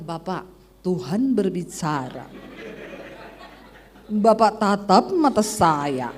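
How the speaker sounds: slow, low mock prophecy; drawn-out, put-on prophetic pronouncement; fake prophet. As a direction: ham up a solemn word from God. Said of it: "Kan banyak kan yang begitu-begitu".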